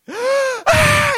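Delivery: dad imitating his son's startled cry; imitated child's wail. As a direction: let out a toddler's bursting wail. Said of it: "then he's like".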